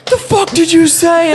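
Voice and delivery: loud, very high mock police yell; outraged cop. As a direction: imitate a cop exploding in disbelief. Retold outraged cop reaction.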